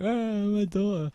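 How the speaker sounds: very high, drawn-out mock lament; mock wail. Retelling a mum losing her daughter, mock wail. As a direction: imitate a distraught mother wailing, slowly. Mum's reaction voiced in retelling.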